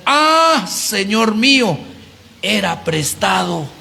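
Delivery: high, drawn-out mock cry; grito de angustia actuado. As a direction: cry out in dismay, stretching each phrase. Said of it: "Y gritó y dijo". (grito lastimero, agudo y alargado)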